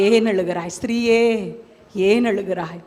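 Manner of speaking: low, measured, gentle asking; tender retold question. The Lord asking a weeping woman why, tenderly.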